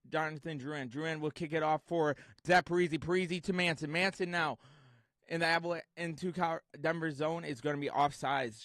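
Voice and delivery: lively hockey play-by-play call. Acted. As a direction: call the game live and fast.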